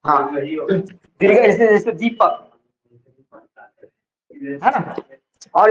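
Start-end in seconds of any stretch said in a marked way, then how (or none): 1.9-2.4 दीपक with the ee drawn out; slow first syllable in दीपक; stressed long vowel. Shown as a demonstration. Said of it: "जहां ज्यादा जोर लग रहा है".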